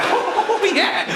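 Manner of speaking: very high, hammed-up jolly voice; jolly fat person. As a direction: squeal it with mock jollity. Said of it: "They're jolly".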